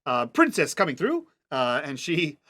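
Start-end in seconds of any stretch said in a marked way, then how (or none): 0.3-1.3 raised-pitch herald's call; playfully officious call; official announcement. Acted. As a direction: clear a path for the princess. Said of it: "adopts, uh, uh, an accent".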